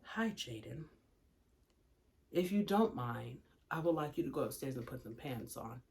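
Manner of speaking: hushed, exaggeratedly patient mother; mock-gentle politeness. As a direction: ask ever so nicely, barely audible. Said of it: "Let me say it again with a smile".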